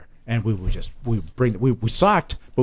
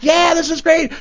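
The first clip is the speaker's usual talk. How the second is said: gleeful; slower than usual. Retold or imitated. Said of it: "I'm like".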